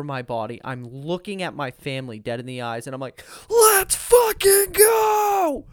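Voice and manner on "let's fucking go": huge high-pitched, drawn-out scream; all-out hype shout. Retold or imitated. Frontman to the crowd as the show starts, screamed hype. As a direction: stretch it into a full-throated battle cry. Reenacting his on-stage hype yell.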